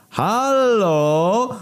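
high laid-back hippie call. Acted. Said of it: "znajomy hipisowski głos". (wysokie, hipisowskie „Halo!”)

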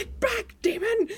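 high-pitched mock panicked yell; Xander. Retelling Xander confronting a demon, mock alarmed shout. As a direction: ward off a demon, high and loud. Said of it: "Xander's all like".

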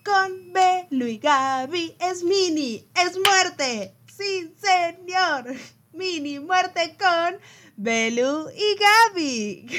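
high, slow announcer voice. Pretend. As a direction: announce it like a TV host. Playful announcer intro.

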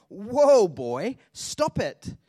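loud, drawn-out halt command; self-scolding shout. Acted. Speaker catching own negative thinking, shouting to stop. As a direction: rein yourself in like a runaway horse.

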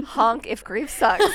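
laughing out loud, slow and loud. Real, not acted. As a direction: laugh through the slogan. Silly honk slogan, laughing.

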